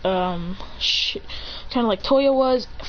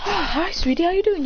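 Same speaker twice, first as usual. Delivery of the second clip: high, sweet phone greeting; doting mother; 7 semitones higher than usual. Acted. Ray answering her son's call, high doting mother. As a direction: greet your child sweetly.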